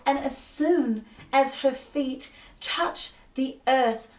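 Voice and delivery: high, loud, soaring narration, slowing at the end; ecstatic storytelling. A narrator's reading. Narrating Inanna's return to the earth, soaring and joyful. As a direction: lift the voice as she rises to the surface.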